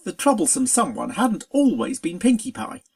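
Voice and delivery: ordinary delivery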